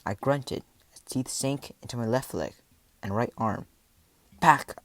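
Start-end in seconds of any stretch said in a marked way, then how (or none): 4.4-4.8 loud, higher, one-word command; fighting yell. A narrator's reading. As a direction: yell the wolves back. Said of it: "I yelled".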